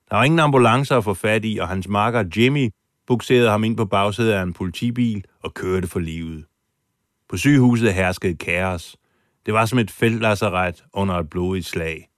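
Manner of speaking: ordinary talk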